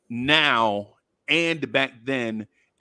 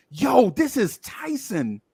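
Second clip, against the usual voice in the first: slow, pitched-up mock introduction; hyped announcement; 6 semitones higher, slower than usual. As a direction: imitate a homeboy announcing a star. Homeboy announces the celebrity.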